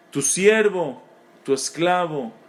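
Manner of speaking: normal talking voice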